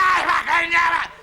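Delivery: loud put-on driver's outburst; hammed-up car-horn fury; mock road rage. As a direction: blast it like an angry driver. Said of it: "somebody goes".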